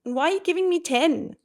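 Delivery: put-on high, loud bewildered kid voice; confused child. Child wondering why mama talks like that, high and loud. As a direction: play the puzzled kid questioning mum. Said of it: "they're gonna be like".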